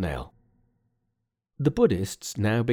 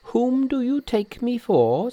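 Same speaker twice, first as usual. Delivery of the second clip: very high, slow Monkey voice; questioning Monkey; 13 semitones higher, 4 dB louder, slower than usual. Acted. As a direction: ask who you are taken for, high and slow. Monkey questions old priest.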